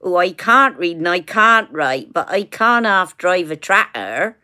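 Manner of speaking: put-on broad West Country Somerset accent. Daughter doing Somerset farmer talk for her mum, Somerset accent. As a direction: put on a thick rural Somerset burr. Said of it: "how you do a Somerset".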